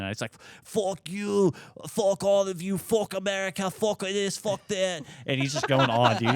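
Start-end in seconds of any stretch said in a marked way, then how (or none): 0.6-5.0 high-pitched imitation of a furious Irishman; mock drunken screaming. Drunk Irishman being dragged out of the bar, mock screaming. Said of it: "he's screaming".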